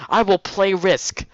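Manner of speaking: high, slow and deliberate; slow mock vow. Misha declaring to Hisao she will play, slow and high. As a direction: spell out the vow like a threat.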